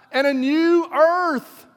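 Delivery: high, slow, ringing on the word new; soaring proclamation. Real, not acted. Preacher proclaiming a new earth to the congregation, high and slow. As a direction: lift "new" high and draw it out, full of wonder.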